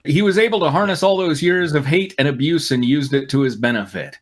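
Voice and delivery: ordinary talk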